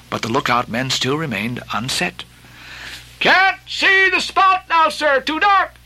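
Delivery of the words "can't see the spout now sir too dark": a high, loud, slow call from far above; cry from the masthead. Acted. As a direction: shout down from the rigging. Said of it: "cried a voice from the air".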